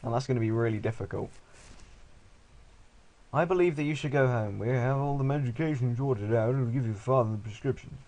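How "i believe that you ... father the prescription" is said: stupid doctor voice. Acted. Player voicing the doctor's dialogue, silly put-on voice. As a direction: do a silly doctor, pitched up at the start.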